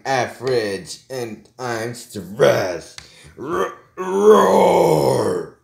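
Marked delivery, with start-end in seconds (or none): 1.6-5.6 worked-up outburst ending in a big loud roar; play-acted angry roaring; stressed roar. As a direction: let the stress burst into a drawn-out roar.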